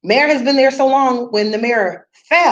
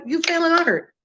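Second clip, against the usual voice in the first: higher-pitched mock outrage at failing art; scolding parent; 5 semitones higher than usual. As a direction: tear into the kid over the report card. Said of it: "I'm like".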